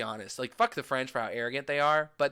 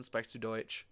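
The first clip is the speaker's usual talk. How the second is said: low, soft, offhand German question; casual German phrase; 4 semitones lower, 10 dB quieter than usual. Shown as a demonstration. Tourist asking a younger German, casual soft question. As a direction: toss off the German casually, lower and quieter. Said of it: "if it's a younger person".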